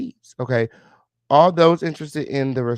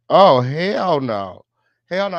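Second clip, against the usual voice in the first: slow, loud "oh hell no"; outraged disbelief; 7 dB louder, slower than usual. Real, not acted. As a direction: drag out the shock at the likes. Sees too few likes.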